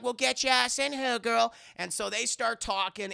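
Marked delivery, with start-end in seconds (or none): 0.0-1.5 high brassy invite; lightly voiced party girl; a touch of a brash girl; Jocasta's voice. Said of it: "She's like".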